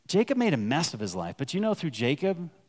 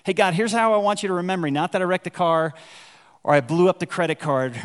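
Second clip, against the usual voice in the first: fast, louder casual plea to God; mock prayer; 3 semitones higher, 6 dB louder, faster than usual. Preacher voices a sinner bargaining with God, fast and loud. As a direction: rattle it off like a brash kid asking a favour.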